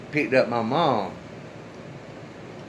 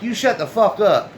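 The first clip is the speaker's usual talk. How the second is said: angry retort; 7 semitones higher, 3 dB louder than usual. Retold or imitated. Snapping at the other man.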